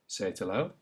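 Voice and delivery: ordinary talk, nothing marked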